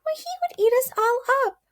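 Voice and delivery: high, frightened mouse queen; scared tiny queen voice; alarmed cry. A narrator's reading. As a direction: gasp it out in fear of the lion. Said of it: "cried the little queen".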